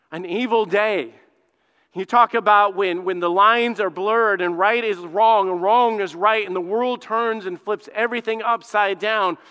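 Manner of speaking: normal talking voice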